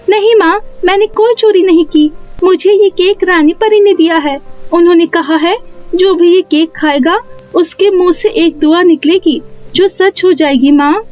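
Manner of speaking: put-on high-pitched child voice, explaining; earnest young daughter. Neha tells her mother the fairy gave the cake, earnest high child voice.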